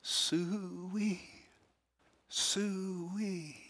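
a longing cow call down a city street; soft high call. Retold or imitated. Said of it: "he starts calling the cows".

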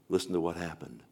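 normal talking voice

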